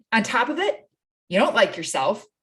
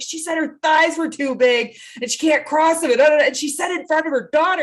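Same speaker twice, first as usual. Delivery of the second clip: put-on higher, louder, eager child's voice; kids tattling; 7 semitones higher, 3 dB louder, faster than usual. Child telling mom what another mom said, eager high tattle. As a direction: play the kid who can't wait to tell.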